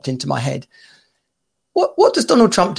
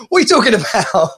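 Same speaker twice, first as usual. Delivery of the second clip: mock indignant; 5 semitones higher than usual. Acted indignant denial of nicking stuff.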